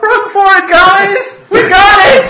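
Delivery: high, very loud yell to the group; calling out. Acted. Player's character calls to companions while fleeing, shouting. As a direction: holler it far up in pitch. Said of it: "I call out".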